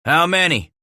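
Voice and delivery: loud high call up the wall; yelled. A narrator's reading. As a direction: yell it up to Carson. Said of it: "He turned to yell at Carson".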